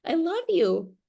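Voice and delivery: put-on high, sweet little kid greeting; sweet child voice. Child greeting mommy the morning after, sweet and high. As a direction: chirp it like an innocent child. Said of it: "the next day they're like".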